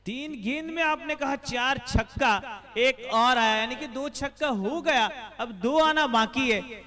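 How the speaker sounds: loud, high burst over a six; excited cricket commentary. Acted. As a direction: call the big hit with a roar. Six hit during commentary.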